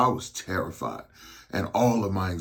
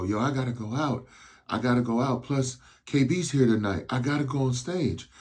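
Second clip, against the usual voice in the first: higher, pleading push to go on; more urgent insistence; 6 semitones higher than usual. Retold or imitated. Injured rapper pleads with tour manager to perform, urgently. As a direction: beg to get back out there, voice climbing.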